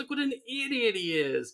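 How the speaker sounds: high-pitched mock indignation; outraged Frank Grimes. Rival exposing Homer to the crowd, outraged. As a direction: point out the fool, exasperated. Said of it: "Frank Grimes is immediately standing up going".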